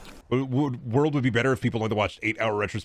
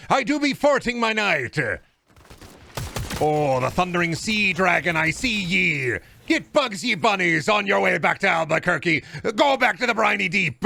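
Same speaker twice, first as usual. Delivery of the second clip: hammed-up pirate; 6 semitones higher than usual. Pirate bit after pirate tests.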